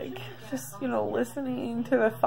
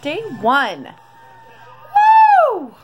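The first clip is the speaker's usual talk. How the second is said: loud, high burst on a whoop; celebratory cheer; 9 semitones higher, 10 dB louder than usual. Real, not acted. Vlogger cheering day one of her diary, excited. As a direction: cheer the fresh start out loud.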